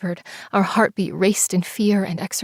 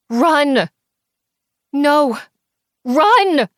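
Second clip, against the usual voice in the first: high, loud desperate scream; 7 semitones higher, 6 dB louder, much slower than usual. Acted. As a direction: scream at her to keep running. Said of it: "I screamed".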